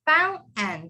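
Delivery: "fountain" as foun, catch, "en"; silent T. Shown as a demonstration. Teacher models fountain for the class, silent T. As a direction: swallow the T in "fountain". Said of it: "Same thing with".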